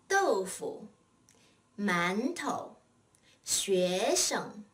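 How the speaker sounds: careful model of 豆腐, 馒头, 学生, leaving 腐, 头, 生 unstressed; neutral-tone second syllable. Shown as a demonstration. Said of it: "the second syllable is spoken in a neutral tone".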